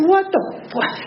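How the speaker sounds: squeaky high startled cry. Retold or imitated. To the ducking girl, a high startled what. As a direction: jump up in pitch, caught off guard.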